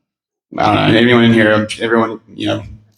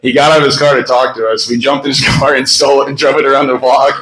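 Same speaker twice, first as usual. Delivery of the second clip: ordinary talk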